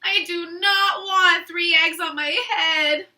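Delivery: loud wailing protest, a sob in it; upset Elephant. Acted. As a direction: Elephant whining he won't have eggs. Elephant upset about eggs.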